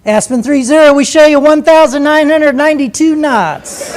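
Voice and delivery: loud, high, self-important radio reply; sing-song controller voice. Retold or imitated. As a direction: sing out the speed like an air traffic controller. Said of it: "Center had to give you that same voice".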